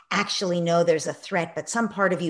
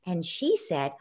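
ordinary talk, nothing marked

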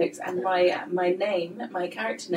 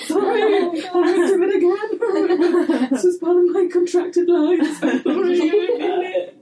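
laughing apology; 10 semitones higher, 4 dB louder than usual. Retold or imitated. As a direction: apologise through laughter, pitched up. Mock apology to actor.

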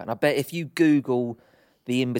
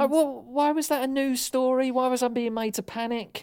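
indignant; 10 semitones higher than usual. Real, not acted. Ranting about news panic.

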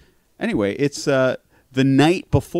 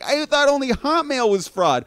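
wife's voice; 12 semitones higher than usual. Retold or imitated. Quoting wife, much higher.

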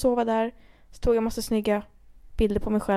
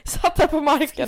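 crying; 5 semitones higher, slower than usual. Retold or imitated. Retelling crying on ground.

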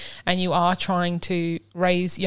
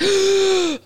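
sharp high gasp of alarm, loud; mock gasp; 13 semitones higher, 5 dB louder than usual. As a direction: gasp as if she just fell. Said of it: "I'm like".

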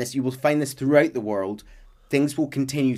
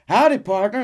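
friendly cowboy greeting; 9 semitones higher, 6 dB louder than usual. Retold or imitated. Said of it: "So you go up and go".

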